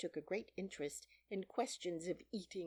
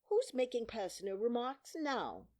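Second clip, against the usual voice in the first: high, louder gloating question; crowing, pointed retort; triumphant; 7 semitones higher, 5 dB louder than usual. A narrator's reading. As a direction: pounce on the slip in triumph. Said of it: "the Hatter asked triumphantly".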